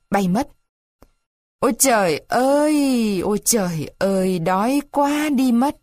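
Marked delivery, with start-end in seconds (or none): 1.6-3.2 "Ôi trời ơi" slow and higher, falling on "ơi"; hungry moan. Acted. Buratino wailing "Ôi trời ơi" from hunger, slow whine. Repeated exclamation. 3.2-5.8 hungry moan. Acted. Buratino moaning from hunger.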